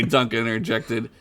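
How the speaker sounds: plain reading voice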